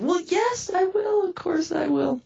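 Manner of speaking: high-pitched emphatic yes; reenacted gushing agreement; eager assurance.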